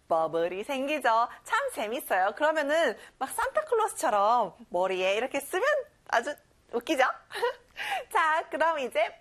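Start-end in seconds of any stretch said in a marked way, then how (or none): none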